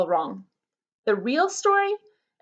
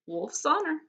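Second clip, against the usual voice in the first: usual speaking voice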